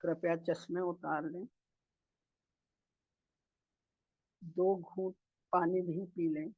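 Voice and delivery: soft, unhurried meditation guidance with long pauses; guided meditation. Real, not acted. Meditation leader guiding masters to sit and breathe, calm guided meditation. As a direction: settle the group gently into stillness, phrase by phrase.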